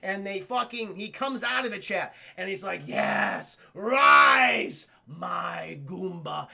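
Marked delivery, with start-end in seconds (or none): 2.8-6.5 slow villain, booming on the middle word then dropping low; hammed-up master raising his monster; mock villain. Villain calls forth his devolved Goomba, grand mock command.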